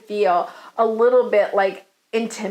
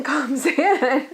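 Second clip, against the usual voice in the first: laughing; 2 semitones higher than usual. Real, not acted. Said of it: "I'm laughing because I don't know".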